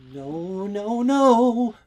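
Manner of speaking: high, loud desperate cry of no; anguished cry. A narrator's reading. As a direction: cry out in dismay as it all breaks. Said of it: "she cries through her smile".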